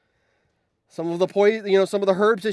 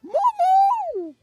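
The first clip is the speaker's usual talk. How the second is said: a betrayed, squealing why; shocked outrage; 4 dB louder, much slower than usual. Real, not acted. Streamer crying out at Momo for killing Yor, very high. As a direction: cry out Momo's name very high in disbelief.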